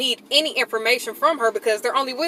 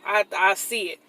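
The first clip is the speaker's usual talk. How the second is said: ordinary talk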